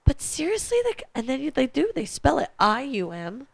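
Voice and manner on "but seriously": high disbelieving question; incredulous. Retold or imitated. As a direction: ask it in disbelief. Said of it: "I'm like".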